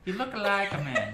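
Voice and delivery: high, loud laughing mock; mocking quote. GM teasing the player's obvious thought, high laughing mock. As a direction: tease the line through laughter. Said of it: "That's exactly what it is".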